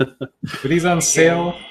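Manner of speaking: slow, high, laughing through it; laughing question. Acted. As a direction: ask while laughing.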